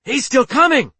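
high, loud shout of alarm. A narrator's reading. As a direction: shout an urgent warning across the bridge. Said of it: "shouted Yanuzi".

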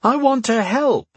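put-on high, slow boy declaring; eager announcement. A narrator's reading. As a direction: proclaim it like a kid. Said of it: "announced Connell".

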